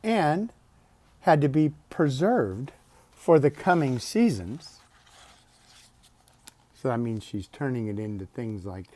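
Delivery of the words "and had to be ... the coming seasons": bright, lifted storybook voice; higher, louder read-aloud lilt; reading to children. Acted. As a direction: read it out like a bedtime book. Reading picture book aloud.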